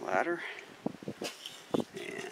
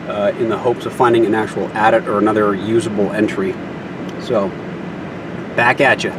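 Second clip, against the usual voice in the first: shouting over wind; 8 dB louder than usual. Real, not acted. Loud talk outdoors in Nevada wind.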